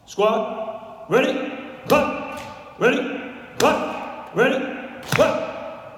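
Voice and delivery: loud, high drill calls in rhythm; drill command cadence. Shown as a demonstration. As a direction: bark the cadence like a Marine instructor. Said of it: "I'll do it first".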